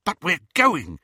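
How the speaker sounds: high, loud, eager burst; exhilarated. A narrator's reading. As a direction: let the thrill burst out. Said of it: "unable to suppress the exhilaration".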